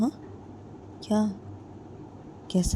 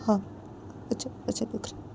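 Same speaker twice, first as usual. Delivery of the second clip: whispered, frightened reply; weak whisper; 5 semitones higher, 5 dB quieter, much faster than usual. A narrator's reading. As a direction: barely get the words out. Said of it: "Ritu उस जिया को बोलती है". (डरी हुई फुसफुसाहट में)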